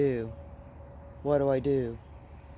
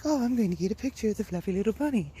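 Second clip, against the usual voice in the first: high, fast mock-cute gush; imitating delight; 8 semitones higher, much faster than usual. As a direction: gush like you just spotted a bunny. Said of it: "and I was like".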